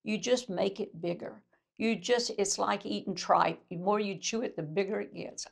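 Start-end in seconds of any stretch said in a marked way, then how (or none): none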